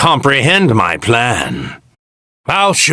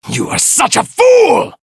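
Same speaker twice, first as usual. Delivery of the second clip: contemptuous shout. Acted. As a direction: roar your contempt at the fool. Villain raging at opponent.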